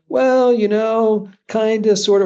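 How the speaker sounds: slow, drawn-out unsure hedging; hesitant prospect. Retold or imitated. Coach imitating a hesitant prospect, slow and unsure. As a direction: play the doubtful customer dragging it out.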